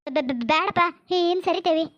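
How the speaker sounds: stuttering in fear; frightened stammer. Acted. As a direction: stumble on first syllables, backing off. Backing off from knife threat. (ಭಯದಿಂದ ತೊದಲುವ ದನಿ)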